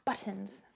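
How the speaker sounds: "Buttons" with a crisp, clearly pronounced T. Shown as a demonstration. Answering commenters who mock how she says button, "Buttons" with a sounded T. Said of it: "I'm saying buttons with a T".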